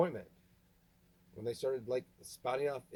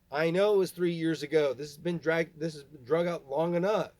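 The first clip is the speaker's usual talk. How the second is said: loud, higher, bitter yell; angry shouting; 4 semitones higher, 7 dB louder than usual. Real, not acted. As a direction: let the pent-up anger out. Yelling at dad Kip.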